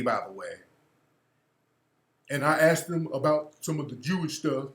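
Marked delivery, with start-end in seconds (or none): 0.0-0.5 much lower, softer throwaway aside; a low muttered by the way; quiet aside. Real, not acted. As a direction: drop it under your breath. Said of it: "He always caping for his community".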